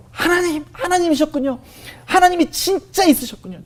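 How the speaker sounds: high, overwhelmed tearful cries to God; crying out in prayer. Retold or imitated. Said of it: "울기 시작하면서". (울먹이며 높은 목소리로 하나님을 부르는 기도)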